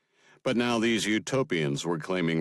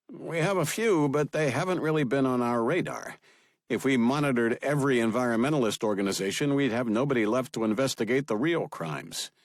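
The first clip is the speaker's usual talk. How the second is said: a lightly raised, higher briefing voice; FBI director; 7 semitones higher than usual. Acted. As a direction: report to the boss, dutiful and measured.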